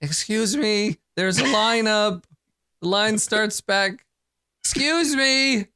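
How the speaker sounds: high, slow snail-lady voice; part-snail woman. Acted. Part-snail woman scolding a queue jumper, high and slow. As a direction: draw it out slowly in a high-pitched voice. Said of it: "some woman, uh, who's, like, part snail".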